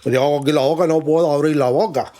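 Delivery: exaggerated posh Spanish voice, mouth barely opening; Tamara Falcó. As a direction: talk like a pijo with an egg in your mouth. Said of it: "lo que dice Tamara Falcó".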